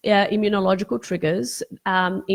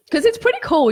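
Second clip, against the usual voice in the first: higher, louder burst of delight; excited; 9 semitones higher, 4 dB louder, faster than usual. Real, not acted. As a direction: lift up with excitement on pretty. Excited aside to viewers.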